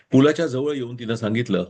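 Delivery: ordinary delivery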